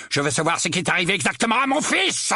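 fast, high-pitched angry demand; angry roar. A narrator's reading. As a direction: demand answers in a rush of anger. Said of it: "rugit l'oncle Vernon". (exigence furieuse et rapide)

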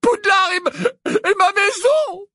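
high, slow, tear-choked plea; tearful pleading. A narrator's reading. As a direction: beg through tears. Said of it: "des larmes ruisselant".

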